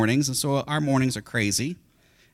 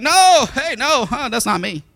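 loud, high flustered no; panicked denial; 9 semitones higher, 10 dB louder than usual. Acted. Peter caught at the fire, loud panicked denial. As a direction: deny it in a panic. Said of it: "And Peter's like".

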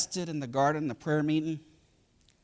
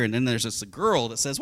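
ordinary delivery

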